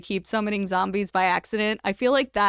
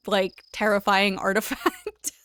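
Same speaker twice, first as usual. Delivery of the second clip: laughing. Real, not acted. Laughing at a fake artifact.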